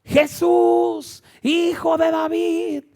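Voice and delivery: high, loud, drawn-out shout; sustained pleading yell; Bartimeo's cry. Acted. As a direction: call out at full voice, holding the vowels. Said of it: "el grito de Bartimeo".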